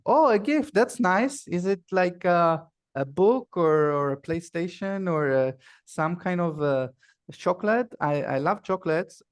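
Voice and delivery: bubbling up with hopeful curiosity; excited child. Acted. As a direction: play a kid thrilled by a gift. Acted child excited about a gift.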